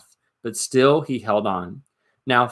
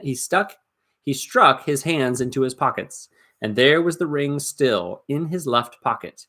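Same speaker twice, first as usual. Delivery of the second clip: usual speaking voice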